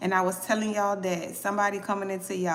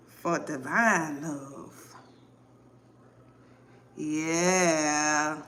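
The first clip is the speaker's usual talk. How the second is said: usual speaking voice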